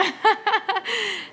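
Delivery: a high, embarrassed giggle. Real, not acted. Host giggling after calling Joel handsome, a high embarrassed laugh. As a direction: giggle at yourself for saying it. Laughing at her own remark.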